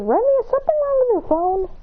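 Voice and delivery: put-on high concerned boy voice; voicing Adrian. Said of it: "Adrian says".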